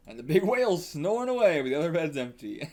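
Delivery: usual speaking voice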